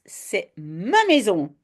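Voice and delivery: ordinary delivery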